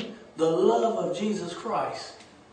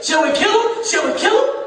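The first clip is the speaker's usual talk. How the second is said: eager; 11 semitones higher, 7 dB louder than usual. Retold or imitated. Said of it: "'Cause he was ready to kill them".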